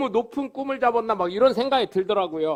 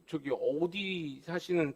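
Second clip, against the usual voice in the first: softer, slow and timid; meek politeness; 4 semitones lower, 9 dB quieter, slower than usual. Retold or imitated. Councilman backing down to the angry voter, softer and meek. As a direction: shrink into a polite mumble. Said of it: "다시 또 공손해져".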